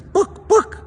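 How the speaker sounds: high squawked chicken voice; chicken cluck. Acted. Chicken asks the librarian, high squawk. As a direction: cluck the word like a hen. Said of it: "Chicken comes back the next day and says".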